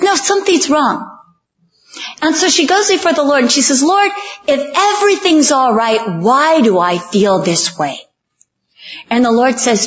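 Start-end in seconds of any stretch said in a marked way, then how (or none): 0.0-1.1 higher-pitched, acted insistence; insistent objection. Acted insistence of worried Rebecca. 3.8-8.0 raised pitch, falling toward the end; acted pleading prayer. Acted anxious prayer of Rebecca.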